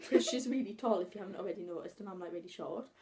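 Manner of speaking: ordinary talk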